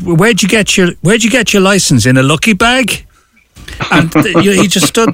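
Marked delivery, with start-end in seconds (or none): 0.0-3.0 imitating a Boston cop, pitched higher; lightly hammed-up Boston cop needling the driver; mock Boston cop. Irish driver retells a Boston traffic cop mocking him, higher, teasing.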